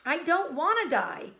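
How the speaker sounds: high, loud scream. Acted. Wilbur screaming, throwing himself down. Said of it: "screamed Wilbur".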